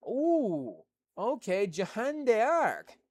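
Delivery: Jeanne d'Arc drawn out slowly in a stab at French; attempted French accent. Shown as a demonstration. Streamer tries the French name for chat, slow mock French. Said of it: "I don't know what accent that was".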